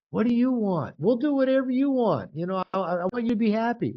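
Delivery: high, eager pleading to please; hammed-up eager nice guy; mock people-pleaser. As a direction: sell how nice you are.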